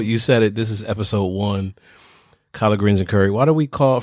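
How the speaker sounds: ordinary talk, nothing marked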